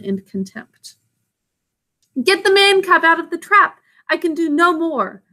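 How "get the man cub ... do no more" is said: high, urgent plea with little actual breathlessness; mildly acted gasp, pitched up. A narrator's reading. Panther to his friends after the fight, gasping. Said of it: "Bagheera gasped".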